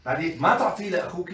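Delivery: usual speaking voice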